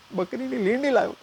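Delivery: outraged punchline. Real, not acted. Comedian to audience, squealing outrage. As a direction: squeal the disgust.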